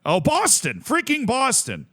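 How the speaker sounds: "freaking Boston" high and exasperated, pitch leaping up; real frustrated excitement; exasperated remembering. Real, not acted. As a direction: groan of realization at a city you forgot. Remembers forgotten city.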